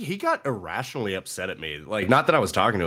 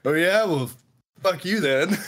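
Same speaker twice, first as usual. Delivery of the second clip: higher, cocky mock comeback; 8 semitones higher than usual. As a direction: fire it back in jest. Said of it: "All right, well, then, fuck you".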